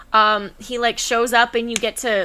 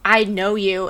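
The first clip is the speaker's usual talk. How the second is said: ordinary delivery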